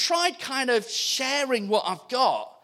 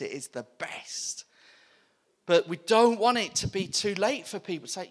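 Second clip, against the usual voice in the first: normal talking voice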